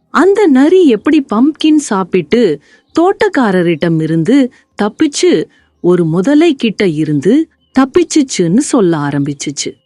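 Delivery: plain reading voice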